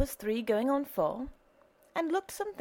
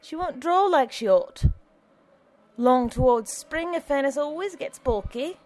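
higher, louder easygoing alderman voice; a cheerful old alderman calling down the stairs; hearty alderman greeting a neighbor; Alderman Mooney; 7 semitones higher, 4 dB louder than usual. Acted. Alderman talks in cellar.

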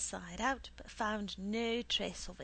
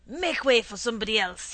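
fast, very loud dismissal; shouting; 2 semitones higher, 12 dB louder, faster than usual. A narrator's reading. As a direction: shout the customer out of the way. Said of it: "he shouted at me".

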